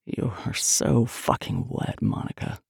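slow, soft low male voice; low murmur. A narrator's reading. Man taunts his lover Monica, low murmur. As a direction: drop low and draw it out.

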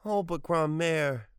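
high, slow, drawn-out plea; piteous wail. A narrator's reading. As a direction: wail it, begging pitifully. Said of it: "he wailed piteously".

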